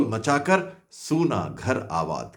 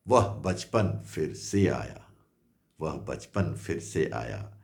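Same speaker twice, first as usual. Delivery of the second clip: fading closing refrain; 8 semitones lower, 8 dB quieter than usual. Acted. Mother finding her childhood returned, fading closing refrain. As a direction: let the last refrain sink away gently.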